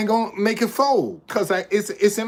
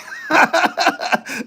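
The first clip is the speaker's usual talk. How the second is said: laugh; 10 semitones higher than usual. Real, not acted. Laughing at wife memory.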